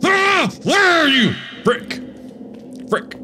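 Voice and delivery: ordinary delivery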